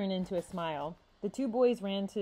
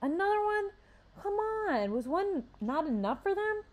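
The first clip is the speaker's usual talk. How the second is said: much higher exasperated disbelief; sarcastic outrage; 12 semitones higher than usual. A narrator's reading. Xavier reacting to news of another killing, high sarcastic outrage. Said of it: "Xavier said in a sarcastic tone".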